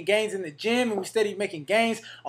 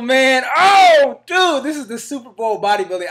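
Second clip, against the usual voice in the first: pumped-up shout, much louder and higher; excited; 4 semitones higher, 7 dB louder than usual. Real, not acted. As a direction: yell it out, totally hyped. Hyped about Mr Olympia trip.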